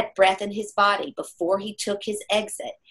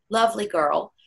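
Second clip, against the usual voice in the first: normal talking voice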